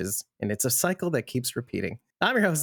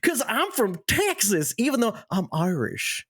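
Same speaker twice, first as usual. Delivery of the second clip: high, drawn-out attempt at an Irish accent; bad Irish accent; 8 semitones higher than usual. Host opens the podcast as an Irishman, bad Irish accent. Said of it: "That was, that was not Irish".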